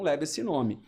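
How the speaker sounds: normal talking voice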